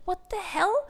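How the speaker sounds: high, baffled inner reaction; exasperated. Retold or imitated. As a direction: let the bafflement out.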